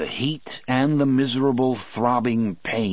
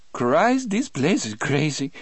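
high, shaky Cajun voice; trembling voice; 7 semitones higher than usual. A narrator's reading. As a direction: tremble with fright. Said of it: "in a trembling voice".

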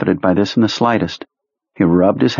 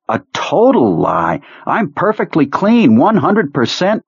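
higher, pleading soldier voice; whiny nervous officer; 9 semitones higher than usual. Acted. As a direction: protest your innocence, higher and anxious. Perlmutter voice.

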